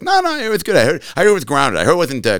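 high put-on Leno voice; imitating Leno reassuring; Jay Leno impression. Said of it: "He's like".

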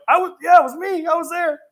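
high, eager exclamation; replayed high-pitched enthusiasm; retold excitement. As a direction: insist excitedly that you saw it. Retells eager answer as witness.

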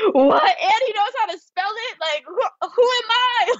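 stunned delight. Retold or imitated. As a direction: marvel that he spelled it. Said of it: "And I was like".

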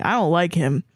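ordinary talk

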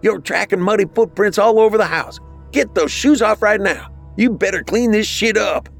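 very high, scolding mother voice; angry screaming mother. A narrator's reading. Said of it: "their mother screamed from downstairs".

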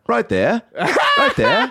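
"Right there" lifted at the end like a question, high; unsure Australian upswing on "Right there". Retold or imitated. Imitating an Australian girl in bed, "Right there" rising like a question. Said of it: "they always go on the upswing".

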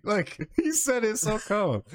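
high laughing talk, slowed down. Real, not acted. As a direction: laugh through the words, high and slow.

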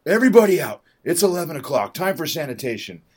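loud, high clearing-out order; aggro shout. Retold or imitated. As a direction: bark everyone out of the room. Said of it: "like, uh, aggro about it".

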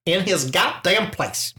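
slow, drawn-out mock tough talk. Mock tough guy banter.

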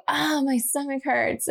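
put-on a touch of a complaining child; whiny child voice. Quoting sick young daughter.

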